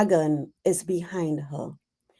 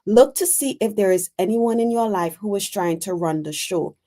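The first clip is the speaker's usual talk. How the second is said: normal talking voice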